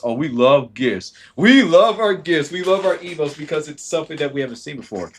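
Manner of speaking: giddy gift lover declaring love; gushing. Acted. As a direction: swoon over the gifts loudly. Gushing about gifts.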